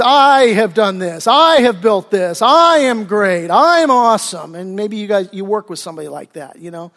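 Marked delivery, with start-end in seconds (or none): 0.0-4.5 loud, slow, self-important bragging; slow, loud declaration of your own greatness; arrogant boast. Acted. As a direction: puff yourself up like a braggart. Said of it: "His I problem is".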